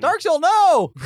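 high, loud cry of dismay; alarmed shout. Acted. Player watching her dragon thrown off the train, high alarmed yell. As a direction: scream for a falling companion.

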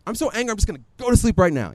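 high, rushed mock outburst; imitating your own angry huff; retold anger. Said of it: "I remember being so angry".

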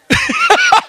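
sudden high squeal of laughter; a high giddy laugh. Real, not acted. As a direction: laugh out in delight. Laughs hard.

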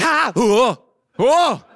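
loud, high startled cries of whoa; loud shocked ha-whoa. Retold or imitated. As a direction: yelp each whoa out in shock. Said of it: "She's like".

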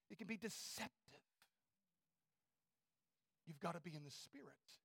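usual speaking voice

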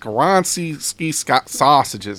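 put-on Skoronski sausages in a Chicago accent; hammed-up Chicago Bears fan voice. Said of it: "say Skoronski sausages".

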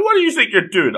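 high-pitched, indignant challenge; mock scolding. Girl catching two kids on the roof, mock scolding. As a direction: imitate someone catching sneaks red-handed.

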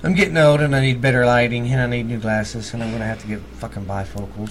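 ordinary talk, nothing marked

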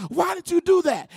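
mock-angry demand, voice jumping up; indignant lifter. Lifter angrily challenging the spotter who grabbed it, indignant. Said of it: "jump off the bench and almost fight".

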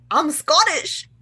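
badly faked Scottish; attempted Scottish accent. Shown as a demonstration. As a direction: have a playful go at a Scottish accent. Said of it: "give us a taste".

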